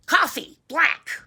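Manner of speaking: loud, punched-out order; grouchy old man. Acted. Old man ordering in the cafe, grouchy. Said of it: "Say it like an old man, grouchy".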